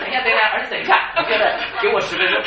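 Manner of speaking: loud, high pretend stalling through laughter; mock plea, laughing. Pastor mock-pleading with his son, laughing loudly. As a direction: beg for ten minutes while laughing. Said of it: "你不能说".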